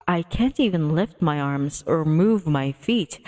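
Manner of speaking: ordinary talk, nothing marked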